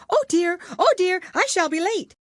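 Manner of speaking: high, loud, flustered rabbit voice. Acted. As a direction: squeak it out like a panicky little rabbit late for something. Rabbit voiced in story reading.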